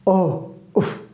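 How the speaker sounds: soft, slow pained sigh of grief; sorrowful sigh. Shown as a demonstration. Teacher demonstrating grief interjections, slow soft sighs. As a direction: breathe it out like a sorry groan. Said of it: "शोक के लिए आह".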